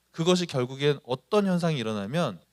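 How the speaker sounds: ordinary talk, nothing marked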